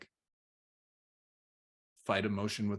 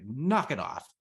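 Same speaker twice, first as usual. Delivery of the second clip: louder, punched exasperated order; stern telling-off; 3 semitones higher, 5 dB louder, slower than usual. Retold or imitated. Dad wanting his crying son to stop, stern and louder. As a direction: snap it at a misbehaving kid. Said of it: "what I really wanna do is just, like, say".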